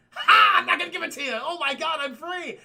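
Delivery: very high, loud gleeful outburst; hammed-up elation. Genie refuses the wish on being freed, hammed-up glee. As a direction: explode with a laugh, then exult.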